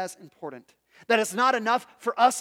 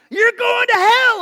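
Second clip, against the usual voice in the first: shrill bullhorn yell, way up high; street preacher yell; 14 semitones higher, 12 dB louder, slower than usual. Retold or imitated. Pastor mocking a street preacher yelling at everybody. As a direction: bellow it like a street preacher damning passersby.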